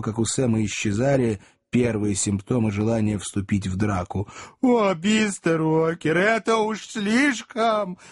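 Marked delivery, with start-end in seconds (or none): 4.6-8.0 weepy wail. A narrator's reading. Job weeps theatrically.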